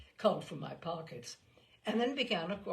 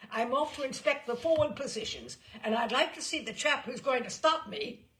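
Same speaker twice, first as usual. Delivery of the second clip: loud, higher defiant boast; 6 semitones higher, 6 dB louder than usual. A narrator's reading. General announces his inspection, loud and defiant. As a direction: dare anyone to stop you.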